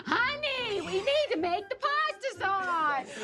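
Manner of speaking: very high put-on mom voice; hammed-up mom falsetto; nagging mom. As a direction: call out high like a sitcom mother. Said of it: "turn into her mom".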